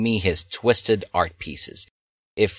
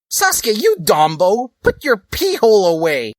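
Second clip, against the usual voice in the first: much higher, louder nagging scold; 13 semitones higher, 6 dB louder than usual. Acted. Dali scolding Sasuke, a high bossy scold. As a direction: tell him off like a bossy girl.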